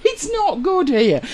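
high, slow whine through laughter; amused, high-pitched moaning; laughing complaint. Real, not acted. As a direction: squeak it out while giggling. Laughing complaint about the heat.

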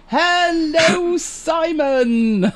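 high, long-drawn hello; delighted high-pitched welcome; effusive greeting. Real, not acted. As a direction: sing out the greeting, stretching each word. Greeting viewer in chat.

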